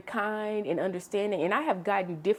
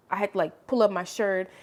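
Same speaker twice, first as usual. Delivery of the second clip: normal talking voice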